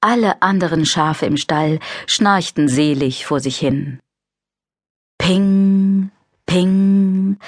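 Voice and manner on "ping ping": high ringing ping sound; ping noise. Acted. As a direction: chime it like a metal ping. (helles Ping-Geräusch)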